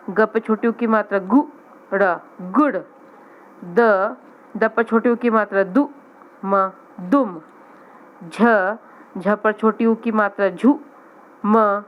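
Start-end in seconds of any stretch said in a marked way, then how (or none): none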